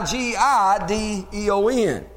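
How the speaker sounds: slow, letter-by-letter spelling; spelled out. Shown as a demonstration. Preacher spelling Gideon's name for the congregation, spelled out slowly. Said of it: "written with an I".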